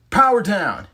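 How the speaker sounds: loud, high team call. Acted. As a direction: call it out together. Rangers demorph call.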